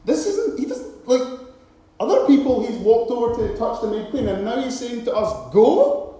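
high, puzzled outburst; can't believe what they heard; disbelief. Acted. Preacher voicing confused lepers after Jesus' order, high disbelief. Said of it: "these guys must have been thinking".

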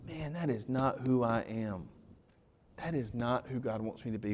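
a hushed, convicted self-talk; quiet inner thought. Retold or imitated. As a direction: murmur the realization inward.

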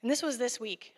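ordinary talk, nothing marked

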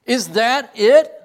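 loud, slow, disbelieving question; stunned professor pressing the point; incredulous demand. Retold or imitated. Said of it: "he repeated for me what I had said".

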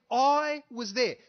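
slow, loud I was there; emphatic. Retold or imitated. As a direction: plant it firmly as the eyewitness. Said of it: "He's saying".

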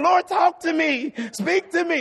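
high, loud mock plea to God; eager congregation praying. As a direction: imitate worshippers boldly begging God to speak. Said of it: "first you were saying".

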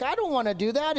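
mildly acted indignant whine; mock angry refusal. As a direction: play Naaman pouting that he won't do it. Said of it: "But now he's angry".

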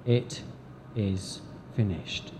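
soft, fading It is finished; quiet, spent last words; dying words, hushed. Acted. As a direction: let the words fall away, hushed. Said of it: "why Jesus said".

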